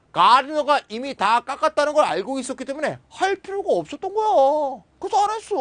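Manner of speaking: high-pitched, emphatic explaining; animated self-justification. Acted. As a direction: protest it as if it were obvious. Voices the person explaining themselves.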